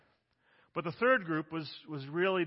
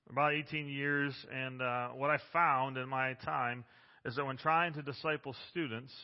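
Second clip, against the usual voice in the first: ordinary talk, nothing marked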